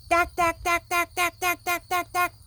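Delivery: high, loud, rapid dack; jackhammer. A narrator's reading. Reading to children, loud high jackhammer. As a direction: hammer it out loud. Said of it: "It makes a loud banging sound".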